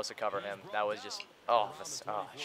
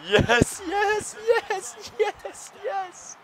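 ecstatic shrieking; 6 dB louder, much slower than usual. Real, not acted. Scoring first touchdown, ecstatic.

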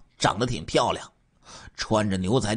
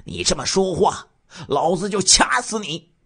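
higher, louder threatening snarl; 9 semitones higher, 4 dB louder than usual. Acted. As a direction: threaten her to shut her up. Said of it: "我就恐吓他说". (提高嗓门恶狠狠地恐吓)

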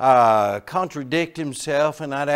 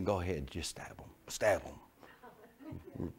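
much softer, whispered inner thought; 8 semitones lower, 13 dB quieter, slower than usual. Retold or imitated. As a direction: breathe it like a secret thought. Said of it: "part of me's thinking".

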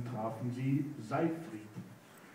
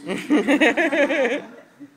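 loud laugh; 12 semitones higher, 12 dB louder than usual. Real, not acted. Narrator laughs at story.

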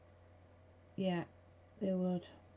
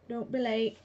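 ordinary talk, nothing marked

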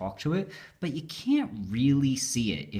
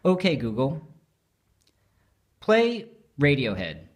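talking to voice assistant; 4 semitones higher, 5 dB louder than usual. Shown as a demonstration. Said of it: "so if I just say".

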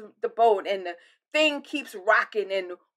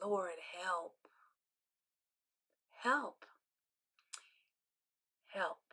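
unvoiced, pleading prayer; whispered plea; 4 semitones lower, 15 dB quieter, much slower than usual. Believer praying quietly for help, whispered.

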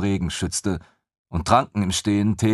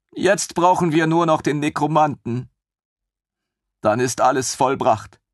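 put-on high, bright-eyed young officer, gushing; a thrilled lieutenant who can't believe his luck; eager lieutenant voice; 7 semitones higher, 3 dB louder than usual. Lieutenant gushing over the queen's griffin, high and eager.

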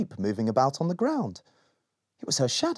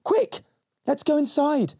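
put-on urgent little girl; 3 dB louder than usual. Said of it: "said Molly".

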